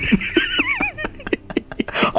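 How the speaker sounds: high squeaky burst of laughter. Real, not acted. Laughing at the title mix-up.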